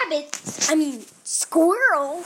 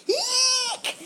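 frightened squeal; 10 semitones higher than usual. Acted. Scared squirrel squeaks.

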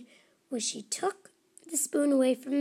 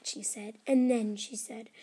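ordinary delivery

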